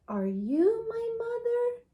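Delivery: put-on high, small baby bird voice, asking; a lost little bird asks each animal. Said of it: "he asked".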